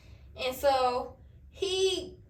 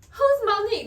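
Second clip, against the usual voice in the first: higher and louder accusation; accusing question; 8 semitones higher, 7 dB louder than usual. Retold or imitated. To the cheating boyfriend, naming the girl, loud accusation. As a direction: throw the name at him like a charge. Confronting him about Monique.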